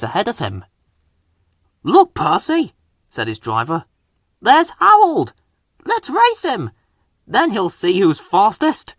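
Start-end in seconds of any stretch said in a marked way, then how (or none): 1.8-8.9 high, eager call to race; rally the engine, raring to go; excited driver. A narrator's reading.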